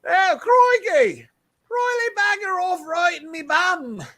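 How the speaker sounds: high, slow, prissy Australian voice; dandy Australian accent. Acted. Donnie the Australian coder, high and slow dandy accent. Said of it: "you sound like a fucking dandy almost".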